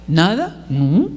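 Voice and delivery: short answers with rising ends; mock-innocent boy caught out; cagey teenage son. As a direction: deny it innocently, voice lifting at the end. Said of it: "entonces le pregunto".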